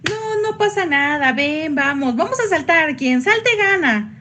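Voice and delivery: high, bright coaxing cheer, slowing at the end; cheerful Joy. Acted. Joy urging Bing Bong to jump, in a high eager voice. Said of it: "y Alegría le dice".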